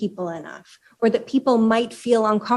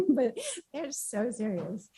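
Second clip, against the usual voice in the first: amused, hushed, still giggling at herself; laughing; 9 semitones higher than usual. Real, not acted. Speaker repeats her line after apologizing for the accent, laughing.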